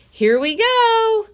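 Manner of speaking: put-on thrilled girl crying out; excited cry. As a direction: cry out as the spin begins. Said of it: "cried Annie".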